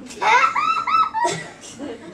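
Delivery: very high laugh at own joke; high squealing laugh. Real, not acted. Cracking up at own muffin joke, a very high laugh.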